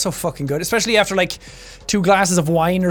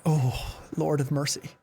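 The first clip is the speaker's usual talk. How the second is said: slow, low, hushed groan; dismayed disbelief; 5 semitones lower, 11 dB quieter, slower than usual. Retold or imitated. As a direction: mutter it softly, as if bewildered. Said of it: "even Irish people are going like".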